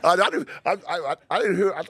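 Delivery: laughing. Real, not acted. Laughing over the mix-up.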